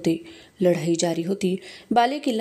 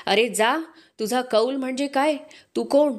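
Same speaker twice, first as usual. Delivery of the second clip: scornful challenge, pitched up; mild angry shout; 6 semitones higher than usual. A narrator's reading. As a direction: throw back the offer with higher, indignant questions. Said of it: "पचकन थुंकून ते ओरडले". (संतापून तुच्छतेने, थोडं ओरडून)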